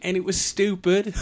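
high, loud put-on actor's voice; hammed-up actor's verdict; Peter Cushing. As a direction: declare it theatrically, in character. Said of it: "Peter Cushing was doing Star Wars".

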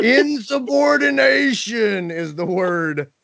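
slow, high, weighty pronouncement; mock dramatic. Host mock-outraged at staff refusing work. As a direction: savour each syllable like a verdict.

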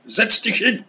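put-on high, loud German accent; Frau Gropius German accent. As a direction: insist kindly in a German accent. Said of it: "in her little German accent".

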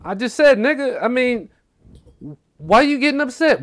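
high, loud imitation of protesting; mock protest. Mondo defending himself in the friend's house, indignant.

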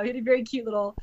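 ordinary delivery

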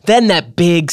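ordinary delivery, nothing marked

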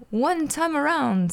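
the Count savours the number; gleeful slow count. Acted.

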